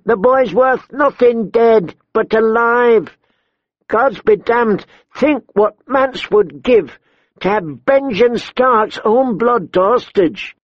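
high, insistent woman's voice; tall woman brigand. A narrator's reading. Tall woman urges gang to take hostage, insistent. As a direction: argue the case in a high pitch.

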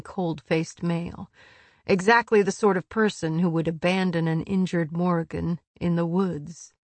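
ordinary delivery

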